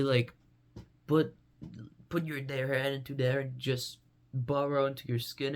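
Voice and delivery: ordinary delivery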